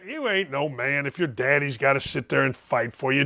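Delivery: ordinary talk, nothing marked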